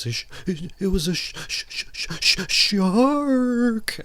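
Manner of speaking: mock Tina stammering sh-, sh-, shark, shrill with fright, over the top; corny terrified stammer. Tina stammering about the shark to Brody, hammed-up terror. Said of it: "really corny as hell to see".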